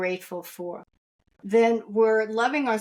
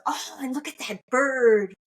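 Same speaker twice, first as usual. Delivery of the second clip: a touch of delighted awe, pitched up; mild wonder; 4 semitones higher than usual. Retold or imitated. Christ points her to a bird, in retold delighted wonder. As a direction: imitate someone marvelling, with a higher lift.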